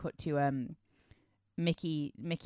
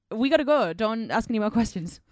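raised, louder urgent command; mock-dramatic rush; 4 semitones higher, 7 dB louder than usual. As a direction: hurry them along, hammed-up. Acts out hurried film dialogue.

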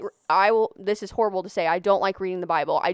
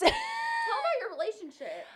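excited urging; 8 dB quieter than usual. Real, not acted. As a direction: egg her on, shouting and grinning. Friend eggs her on loudly.

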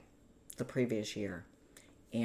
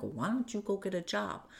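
higher-pitched retold retort; indignant retort; 7 semitones higher than usual. Woman retelling her retort to her partner, indignant. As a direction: fire back, put out. Retelling an argument over money.

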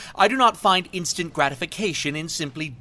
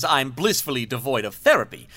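ordinary delivery

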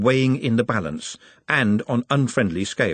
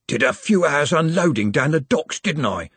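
mildly defensive, higher-pitched tag question; 6 semitones higher than usual. A narrator's reading. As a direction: justify yourself, pitched up a little. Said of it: "he said defensively".

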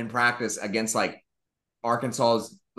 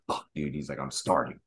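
dismayed mutter; 10 semitones lower, 7 dB quieter than usual. Retold or imitated. Backup told he's starting.

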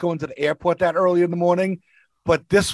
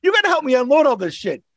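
retold yelling; 8 semitones higher than usual. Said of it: "I start yelling at my passenger".

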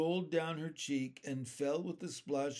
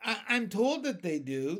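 higher-pitched, mildly bashful ladybug; a touch of modesty, pitched up; modest; 7 semitones higher, 6 dB louder than usual. A narrator's reading. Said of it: "the ladybug answered modestly".

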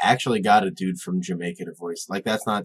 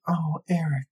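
swooning mermaid; 8 semitones higher than usual. Retold or imitated. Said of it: "she'd probably be like".

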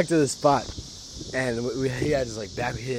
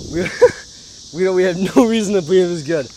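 laughing disbelief; 6 semitones higher, 9 dB louder, faster than usual. Real, not acted.

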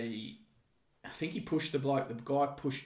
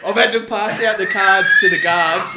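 laughing; 7 semitones higher, 17 dB louder than usual. Real, not acted. Teller laughing at own story.

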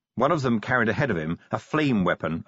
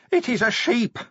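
high-pitched acted wail; weeping; 12 semitones higher than usual. A narrator's reading. Creature weeping over the wrong animal, tearful. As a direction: lament it in a high, weepy voice. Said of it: "it wept".

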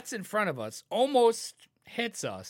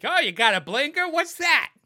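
high, loud mock-helpful jeer; sarcastic loud heckle at another driver; sarcastic taunt; 8 semitones higher, 9 dB louder than usual. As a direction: taunt a driver about the blinker. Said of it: "It's like".

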